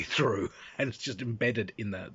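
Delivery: ordinary delivery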